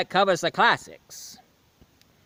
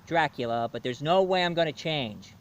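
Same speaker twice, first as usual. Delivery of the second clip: ordinary delivery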